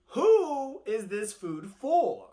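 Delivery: slow, loud, drawn-out question; a trickster playing innocent; big, slow, knowing ask; sly tortoise voice. Tortoise asks slowly, loudly.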